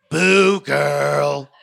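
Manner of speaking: a hint of a fake cheerleader; perky catchphrase. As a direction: sell the motto like a cheesy host. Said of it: "Can you say".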